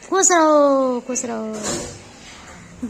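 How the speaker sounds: slow, drawn-out blessing like a grandparent; elder's blessing. Pretend. Mother answering the child's pranam as a blessing elder, slow.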